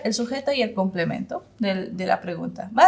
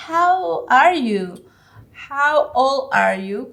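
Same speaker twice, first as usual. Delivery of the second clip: very slow, louder model questions; slow teaching model; 4 semitones higher, 5 dB louder, much slower than usual. Shown as a demonstration. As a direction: stretch each word out for learners. Teacher models English questions for learners.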